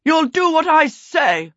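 high, loud villainous command; threatening prince. A narrator's reading. Prince holding a child hostage, high commanding threat. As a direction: demand obedience as a villain prince.

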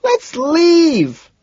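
very high, loud shout. A narrator's reading. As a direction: shout it over the music. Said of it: "she shouts".

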